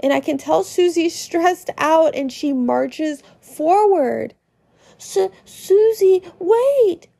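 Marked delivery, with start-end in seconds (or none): 5.0-7.1 higher calling out to Susie; Ralsei calling. Acted. Ralsei calling after Susie as she marches off. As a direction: call after her, urgent. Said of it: "Ralsei calls".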